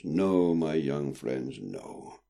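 soft, slow and gentle; calm the children down; reassuring. A narrator's reading. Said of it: "smiled in reassurance".

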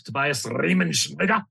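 Tobias Riemenschneider said the German way, with a rolled R and a crisp sch; German name said correctly; careful German pronunciation. Shown as a demonstration. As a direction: give Riemenschneider its proper German sounds. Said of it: "Just gotta say it the right way".

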